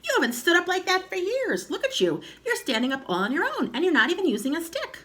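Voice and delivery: put-on high, amazed boyish rush; excited crying out. A narrator's reading. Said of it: "George cried".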